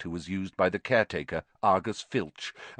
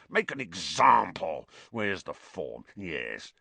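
furious muttering; slower than usual. A narrator's reading. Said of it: "he muttered furiously".

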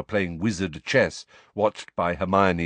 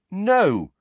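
much higher, louder indignant denial; 11 semitones higher, 5 dB louder than usual. A narrator's reading. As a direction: reject the accusation hotly. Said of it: "said Harry indignantly".

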